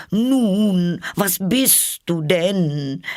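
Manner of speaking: high, slow bird voice demanding; high suspicious pigeon question; pigeon asking. A narrator's reading. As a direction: peck out the question slowly. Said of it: "fragte die Taube".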